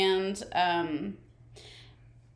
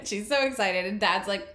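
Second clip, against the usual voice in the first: ordinary talk, nothing marked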